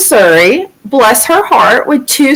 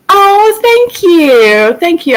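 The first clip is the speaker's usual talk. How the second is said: delighted thanks; 7 semitones higher, slower than usual. Real, not acted. Thanking viewers in live chat.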